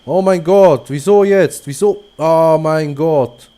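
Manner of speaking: loud frustrated "oh my god", stretched out at the end; exasperated outcry. Real, not acted. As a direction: shout at the game in disbelief. Car breaks down near goal.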